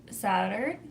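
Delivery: ordinary talk, nothing marked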